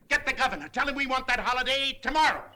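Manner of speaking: loud urgent shout. Acted. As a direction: bark the orders down the phone. Urgent phone orders.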